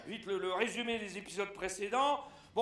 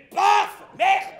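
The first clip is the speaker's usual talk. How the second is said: shouted much higher and louder; mock shout; 9 semitones higher, 15 dB louder than usual. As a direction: blurt it out in comic dismay. Récit comique, déception. (cri de dépit comique)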